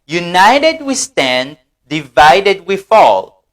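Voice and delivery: "United we stand, divided we fall" dit lentement, syllabe par syllabe; slow model reading. Shown as a demonstration. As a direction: read the motto slowly for the class to repeat. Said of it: "L'union fait la force". (lentement, "United we stand, divided we fall")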